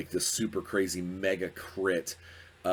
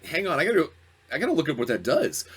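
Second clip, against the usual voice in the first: higher and louder, hurried; flustered; 7 semitones higher, 5 dB louder, faster than usual. Retold or imitated. DM caught off guard, hurrying to look up the poison, higher and louder. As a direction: scramble like a caught-out game master.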